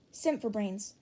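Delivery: ordinary delivery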